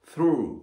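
through with the tongue lightly between the teeth on th; English through. Shown as a demonstration. Teacher showing students, careful English through. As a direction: through said with a bitten-tongue th and a rolled ru. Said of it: "ruは舌丸めますね".

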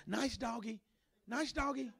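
very soft, careful coaxing; timid placating. Acted. Scared churchgoer to the devil as dog, hushed coaxing. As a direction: barely voice it, as if soothing a dangerous dog.

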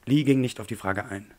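ordinary delivery, nothing marked

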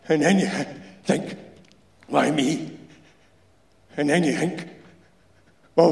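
a dying man telling it straight, rough and resigned; dying Glasgow worker. Acted. Insulator telling the young electrician about his asbestosis, blunt and resigned.

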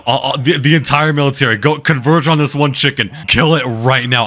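angry orders. Real, not acted. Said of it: "I'm so fucking mad right now".

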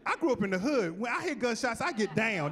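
normal talking voice

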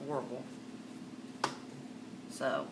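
normal talking voice

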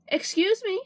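high polite driver. A narrator's reading. Truck driver speaks.

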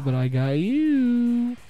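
high, drawn-out gloat. Real, not acted. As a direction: stretch it out high, playfully gloating. Gloating at catching the opponent.

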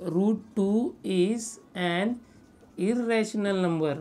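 very slow, word-by-word dictation; dictating. Real, not acted. Teacher gives students statement Q, dictating slowly. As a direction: draw out each word for writing.

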